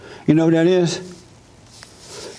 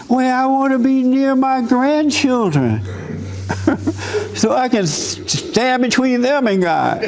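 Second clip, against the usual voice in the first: higher, sweetly self-justifying mother with laughs breaking in; mama explaining why she moves to Russia, higher and laughing; mock mama voice; 8 semitones higher than usual. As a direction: play the meddling mother, amused at her. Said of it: "mama explains her move".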